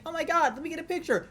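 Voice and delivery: high-pitched fanboy gush; starstruck fan. Retold or imitated. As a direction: beg for a photo like an excited kid. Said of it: "You're just like".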